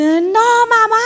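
high, loud, stretched-out wail; hammed-up panicked cry to mom; dramatic mock scream. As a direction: imitate a drama-queen child shrieking in horror.

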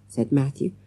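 ordinary delivery

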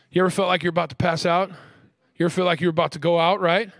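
normal talking voice

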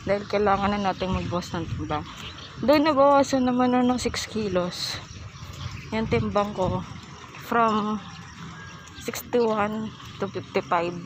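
muffled behind cloth mask; speaking masked while walking; through a face mask. Real, not acted. Seen 0: Blue cloth mask covers mouth and nose throughout, outdoors walking.

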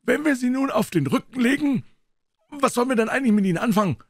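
put-on a high eager young sailor; young Dick. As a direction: stammer it like a nervous lad.

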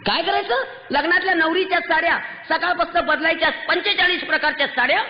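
outraged preacher at full force; real shouting. Real, not acted. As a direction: shout it out to the whole hall. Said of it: "ओरडू नको तर काय करू?".

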